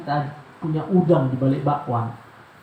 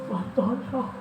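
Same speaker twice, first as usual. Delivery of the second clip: mock crying prayer; 9 semitones higher than usual. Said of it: "langsung nangis".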